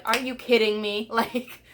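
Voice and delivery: like the universe is out to get you; exasperated disbelief. Retold or imitated. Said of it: "and I was just like".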